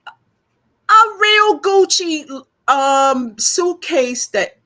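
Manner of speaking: high, loud, drawn-out wail; anguished lament. Real, not acted. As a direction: wail over a treasured lost possession. Lamenting her lost prized belongings.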